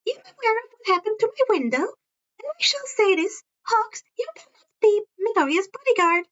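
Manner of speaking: high, chirpy principal voice with a sly edge; Principal Netsu, high and chirpy. A narrator's reading. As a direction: pitch it up like a cheerful little animal principal.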